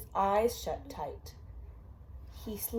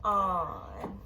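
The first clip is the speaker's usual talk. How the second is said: slow drawn-out refrain; 4 dB louder, much slower than usual. A narrator's reading. Parent reading a bedtime book to a child, slow drawn-out refrain. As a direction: stretch each word of the refrain, slow and loud.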